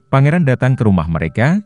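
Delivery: plain reading voice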